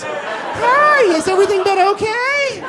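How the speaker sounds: high-pitched, over-polite service rep; customer service voice. Retold or imitated. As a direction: parody a call-center courtesy script. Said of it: "I get, like, customer service voice".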